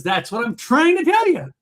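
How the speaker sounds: high triumphant punchline; clerk punchline. Retold or imitated. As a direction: deliver the punchline with a snap. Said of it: "And he says".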